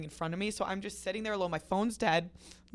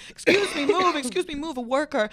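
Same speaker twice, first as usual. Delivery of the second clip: higher, louder mock urgency; retold calling out; 6 semitones higher, 7 dB louder than usual. Everyone pushing past in the aisle, loud mock-urgent calls. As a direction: call out as if pushing through, hammed up.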